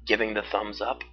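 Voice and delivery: usual speaking voice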